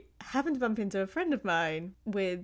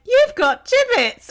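put-on much higher, louder, slow kid announcement; child's exclamation; 14 dB louder, slower than usual. Imitating the friend's son announcing her shoe charms, high and loud. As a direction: call it out like a kid spotting something. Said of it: "her son is like".

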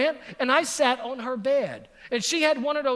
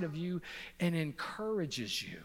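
soft close; 6 semitones lower, 10 dB quieter than usual. Real, not acted. Preacher drops to intimate close.

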